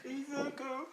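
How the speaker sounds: hammed-up blubbering father; mock crying. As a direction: whimper it like a big baby. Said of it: "he's crying like a big baby".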